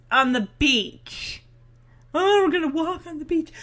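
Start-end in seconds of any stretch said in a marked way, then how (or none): 2.1-3.5 higher, quick mock-swoon; mocking romantic gush. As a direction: make fun of a tropey romantic line. Mocking tropey beach-walk romance.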